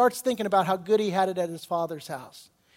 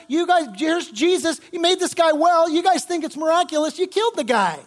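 loud, high, impassioned accusation; Peter accusing; 9 semitones higher, 6 dB louder than usual. Acted. Preacher as apostle blaming the crowd for Jesus' death, fiercely accusing. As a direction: drive the charge home at full force. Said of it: "Peter says".

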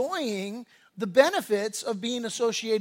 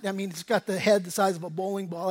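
ordinary delivery

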